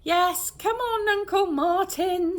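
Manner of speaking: high, loud, slow fake sweetness; overplayed coaxing. Rose coaxing Murden in, hammed-up and slow. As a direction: sell the cover story hard. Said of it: "Rose overplaying the cover".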